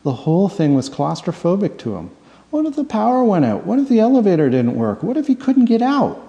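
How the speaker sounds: usual speaking voice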